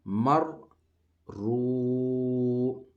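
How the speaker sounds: رو said full-mouthed and heavy, loud and high; teacher modelling a thick رو. Shown as a demonstration. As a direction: fill the mouth on رو after مر. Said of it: "را کو ہم نے موٹا پڑھنا ہے".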